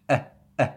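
"uh, uh" as two abrupt throat stops; glottal sound. Shown as a demonstration. Teacher isolating the first sound of empty, glottal stop. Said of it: "That is a glottis".

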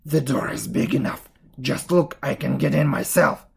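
forceful, loud witch voice; exasperated witch. A narrator's reading. Witch showing Gretel the oven door, loud and exasperated.

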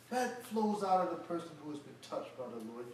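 ordinary delivery

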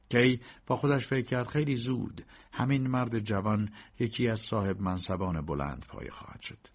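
plain reading voice

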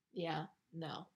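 ordinary talk, nothing marked

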